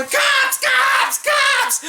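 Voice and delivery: frantic lookout shout; yelling cops. Retold or imitated. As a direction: scream the warning as he flees. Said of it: "he's yelling".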